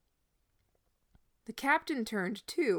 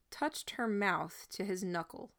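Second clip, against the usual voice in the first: ordinary delivery, nothing marked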